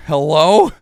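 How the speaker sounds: loud, sharp hello; calling out. Real, not acted. Streamer interrupted, calling out loudly to someone off mic. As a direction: call out to whoever is interrupting.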